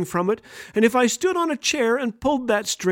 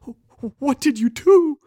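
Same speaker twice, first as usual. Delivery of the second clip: slow, louder, high and scared; frightened little brother; 7 semitones higher, 4 dB louder than usual. Retold or imitated. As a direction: stammer it out wide-eyed with fear. Scared boy asks about alien.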